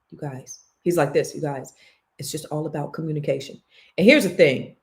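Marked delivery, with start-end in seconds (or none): 0.1-0.6 soft-spoken. Retold or imitated. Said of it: "very soft-spoken". 1.3-3.6 soft-spoken. Retold or imitated. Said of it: "very soft-spoken".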